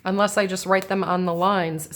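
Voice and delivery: normal talking voice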